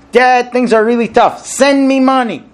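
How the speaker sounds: demanding read. Retold or imitated. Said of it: "So one neighbor reads it this way".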